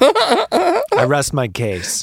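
slow, high, laughing declaration; slow self-satisfied closing line through a laugh; mock triumph. Real, not acted. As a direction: deliver it like a gloating lawyer, laughing.

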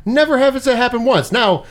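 ordinary talk, nothing marked

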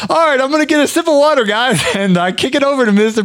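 very high, rushed host impression; Brian. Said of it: "He's always like".

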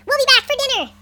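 high, loud call to parents; calling out goodbye. A narrator's reading. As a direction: call back cheerfully as you leave. Kids call to parents on leaving.

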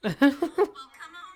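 high falsetto giggle; eerie high-pitched titter; creepy high laugh. Retold or imitated. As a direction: cackle like the creepy worker. Mock creepy laugh of worker.

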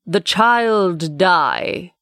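slow, weighty commanding voice; archangel voice. Acted. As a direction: pronounce it like a mighty angel. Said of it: "Then the archangel's voice was heard".

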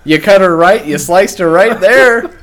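mock a Canadian calling the shot; Canadian accent. Teasing a friend's golf commentary, imitated Canadian accent. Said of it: "He already has a Canadian accent".

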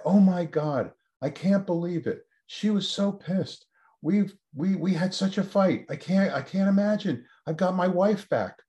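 high-pitched, overwhelmed disbelief, retold; imitating astonished husband. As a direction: act out a husband who can't believe his luck. Husband phones, amazed his wife speaks.

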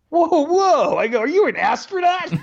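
high, astonished double take; mock amazement at the astronaut reveal; Whoa, whoa, high and startled; stunned disbelief. Stunned realisation retold.

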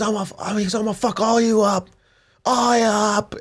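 put-on high-pitched drunken threat; wasted drunk threat. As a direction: ham up a sloppy drunk picking a fight. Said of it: "He's wasted".

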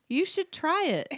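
high, singsong coaxing. A narrator's reading. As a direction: tempt a reluctant friend to taste. Story character coaxes a friend.

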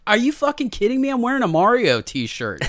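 high, loud mock outrage; indignant protest. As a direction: protest incredulously through the door. Retold indignant protest at door.

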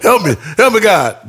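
high-pitched cry for help; hammed-up panic; mock panicked cry. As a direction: plead as if on fire. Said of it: "Yell like he on fire".

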